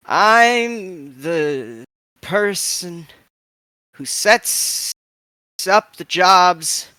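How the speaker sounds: put-on slow, halting old voice with stammers; slow stuttering old woman. Oma Mankfeld introducing herself to the crew, slow and stuttering. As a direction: stammer slowly like a frail but sharp old woman.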